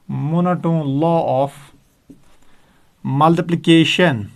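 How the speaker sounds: monotone law of multiplication spoken very slowly, each word apart; slow dictation. Shown as a demonstration. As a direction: dictate the term for students to write. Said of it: "term repeated slowly".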